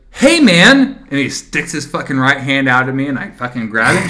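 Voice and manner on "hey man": mock teen greeting. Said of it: "he goes".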